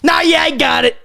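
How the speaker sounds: high, insistent brush-off; imitating father. Father assuring son he got joke, imitated insistence. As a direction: wave off the question as a dad.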